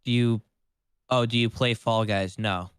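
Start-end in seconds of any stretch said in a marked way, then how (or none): none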